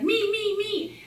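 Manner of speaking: high, loud eager "me, me, me"; a little boy begging to be picked; eager kid calling out. Retold or imitated. Child begging his teacher, calling out eagerly.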